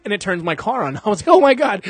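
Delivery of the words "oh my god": relieved cry. Retold or imitated. Car finally starts, relief.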